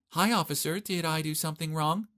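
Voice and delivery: high, sugary flirt voice to the cop; weird sweet voice. Acted. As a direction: play innocent to the officer. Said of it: "began to speak in a weird way".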